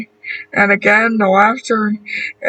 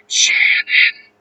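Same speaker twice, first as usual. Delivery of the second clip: whispered. A narrator's reading. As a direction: breathe the name out. Written name, read unvoiced.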